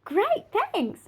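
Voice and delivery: high, bright mock thanks; imitating Penny. Friend thanking him for chocolate, high mock voice. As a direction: chirp it up high as the grateful friend. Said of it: "She goes".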